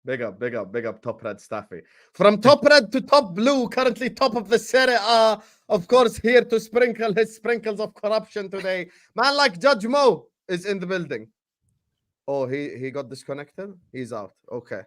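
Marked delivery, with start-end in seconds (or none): 2.1-11.3 big showman welcome, pitch up; hype host intro. Acted. Host bigging up Judge Mo to viewers, hype announcer voice. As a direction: announce him like a ring entrance.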